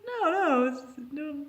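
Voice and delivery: high, hurried mock-innocent pleading; nervous denial. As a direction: squeak out an innocent cover-up. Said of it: "I'm like".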